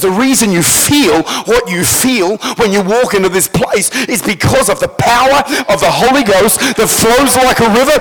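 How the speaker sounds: loud, high, driving sermon delivery; full-force preaching. Real, not acted. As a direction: build to a thundering pulpit climax.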